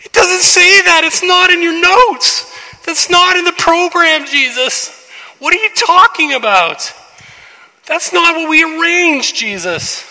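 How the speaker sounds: high, loud, slow mock objection; indignant disciples. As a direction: protest that it's not in the script. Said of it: "the disciples are like".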